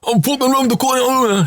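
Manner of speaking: high, slow hammed-up Geordie; Geordie impression. Imitating a Geordie friend on the phone, broad Geordie accent. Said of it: "that's a Geordie's impression of me".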